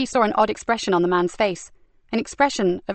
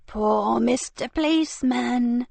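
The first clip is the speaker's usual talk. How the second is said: sneering villainess; 3 semitones higher, slower than usual. Acted. Frankie taunts Stevie over the captive, slow sneering. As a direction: savour the cruelty like a treat.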